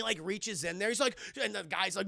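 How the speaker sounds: normal talking voice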